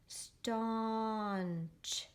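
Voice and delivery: STAUNCH with the aw held very long; blended reading demo. Shown as a demonstration. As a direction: sound out "staunch" in one slow glide to ch. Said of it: "I'm gonna read it like this".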